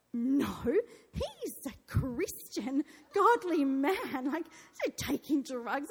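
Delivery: slow, drawn-out mock indignation; shocked. Wife answering the operator's drugs question, shocked and indignant. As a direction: stretch out the words in affronted disbelief. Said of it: "moment of shock".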